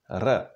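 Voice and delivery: "R" fully rolled, with friction; rolled Czech R. Shown as a demonstration. Teacher shows the first letter, "R" fully rolled. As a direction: sound "R" as a Scottish trill. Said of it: "R in Czech is fully pronounced".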